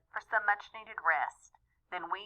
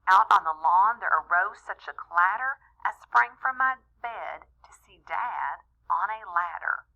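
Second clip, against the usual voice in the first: plain reading voice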